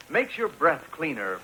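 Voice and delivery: ordinary talk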